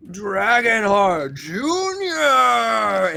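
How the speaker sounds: high, loud, drawn-out arena introduction; ring announcer. Acted. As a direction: stretch the name like a wrestling announcer. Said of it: "the announcer goes".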